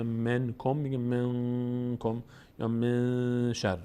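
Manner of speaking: منکم with the nun hidden in the nose; nasal hiding of noon. Shown as a demonstration.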